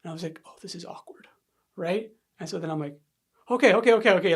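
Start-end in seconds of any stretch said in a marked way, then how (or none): 0.4-1.3 very soft, awkward inner thought; hushed aside. Retold or imitated. Son thinking to himself mid-hug, hushed. 3.5-4.3 loud, higher string of okays; urgent tapping out. Retold or imitated. Son tapping out of father's hug, urgent and loud.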